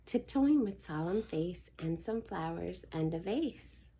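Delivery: usual speaking voice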